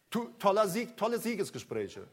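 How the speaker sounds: normal talking voice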